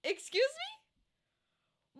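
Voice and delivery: squeaky, very high and slow excuse me; high mock-offended disbelief; mock outrage. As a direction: play it scandalized, drawn out. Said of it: "I'm gonna be like".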